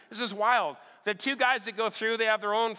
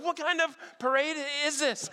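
bewildered; 6 semitones higher than usual. Retold or imitated. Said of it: "I'm going".

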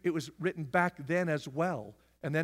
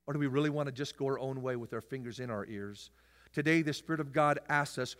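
ordinary talk, nothing marked

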